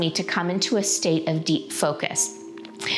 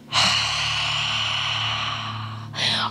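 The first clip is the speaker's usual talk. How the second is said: long constricted throat exhale; ocean throat breathing. Shown as a demonstration. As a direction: breathe out like fogging a mirror. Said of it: "So we take a full breath in".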